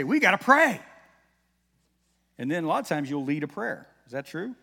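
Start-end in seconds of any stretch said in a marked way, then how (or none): 0.0-0.8 loud, high mock announcement; raised-voice quote, urgent and high; calling out. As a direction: call everyone to a halt, imitating an eager kid. Acting out child stopping everybody.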